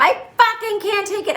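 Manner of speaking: very high, strained mock scream; imitated scream. Wife goes off on her husband in the street, imitated screaming. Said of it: "she's, like, screaming at him".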